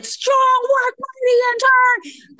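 high, loud, slow cheer; cheering praise. Retold or imitated. Senior resident praising the intern on the phone, loud cheer. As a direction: hail the intern like a hero. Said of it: "She said".

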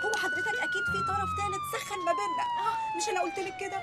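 panicked squeal. Acted. High-pitched panic in arrest.

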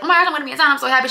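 high, loud gushing joy; excited. Retold or imitated. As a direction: burst out thrilled and chirpy. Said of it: "but I was like".

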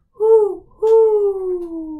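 loud high owl call; owl hoot. Acted. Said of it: "he flaps his wings and he says".

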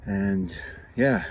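ordinary delivery

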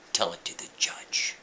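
low whispered brush-off; cold whisper. Retold or imitated. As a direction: hiss it dismissively under the breath. Cop whispers cold reply.

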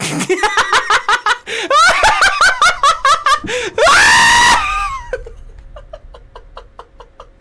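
非常に高く大きな長い爆笑; 腹を抱えて叫ぶように笑う; 絶叫笑い. Real, not acted. 爆笑して絶叫. (甲高い大爆笑)

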